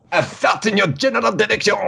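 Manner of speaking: high-pitched, hammed-up comic taunter; mock taunting. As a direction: hurl it as a silly high-voiced insult. Quoting a Monty Python taunt.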